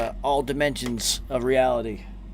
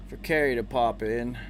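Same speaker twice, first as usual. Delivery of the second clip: ordinary delivery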